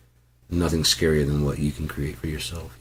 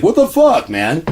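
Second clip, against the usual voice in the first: high loud what the fuck; shocked outburst; 11 semitones higher, 8 dB louder than usual. Retold or imitated. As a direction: blurt it out in total disbelief. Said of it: "You're like".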